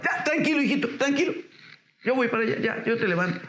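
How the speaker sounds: put-on high, tender comfort to a fallen child; soothing parent. Parent calming a child who fell, high and tender. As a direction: coo to a little one who fell. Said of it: "qué es lo que primero que dice la mamá".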